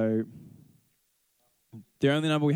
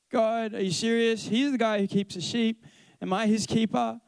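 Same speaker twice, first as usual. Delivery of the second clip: mildly cheeky, high-pitched backtalk; being a punk; 7 semitones higher than usual. Acted. Cain answering God about his brother, lightly punkish backtalk. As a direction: toss it back with indignant disbelief, pitched up. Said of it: "he's being a punk to God".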